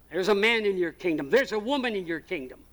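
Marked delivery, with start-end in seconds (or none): none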